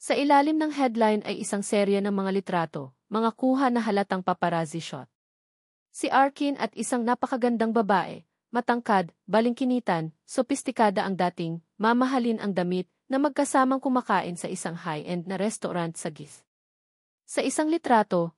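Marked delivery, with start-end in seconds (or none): none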